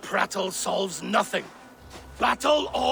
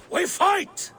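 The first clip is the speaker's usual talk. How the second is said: high-pitched rallying shout; battle cry; 9 semitones higher than usual. Acted. Warrior urges fellow gods to fight, a high battle cry. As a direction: fling it out as a call to arms.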